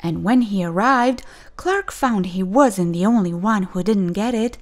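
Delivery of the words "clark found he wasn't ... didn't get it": ordinary delivery, nothing marked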